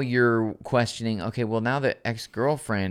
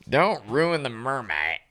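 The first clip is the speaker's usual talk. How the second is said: mock drawl; 4 semitones higher than usual. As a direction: drag it out like a country joke. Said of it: "sounds like something you'd say".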